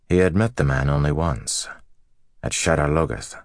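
ordinary delivery

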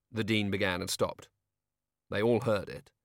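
plain reading voice

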